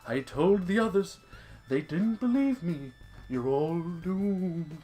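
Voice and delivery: doomsayer voice, slow and raspy; slow ominous raspy drawl. Retold or imitated. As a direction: drag out the warning like a crazy prophet. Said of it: "That's pretty good".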